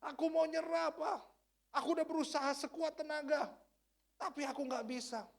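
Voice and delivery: a tearful son crying out to his dad; injured son pleading. Retold or imitated. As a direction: beg your father to let you quit. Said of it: "dia teriak".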